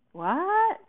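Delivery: high, loud rising question; baffled. Acted. Set director not getting the order, baffled. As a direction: squawk it in blank confusion.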